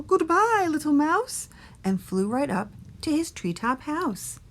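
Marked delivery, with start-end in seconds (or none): 0.0-1.5 high-pitched owl voice; screeching owl. A narrator's reading. Owl takes leave of mouse, high screechy voice. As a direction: pitch it up like a fleeing bird. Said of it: "screeched Owl".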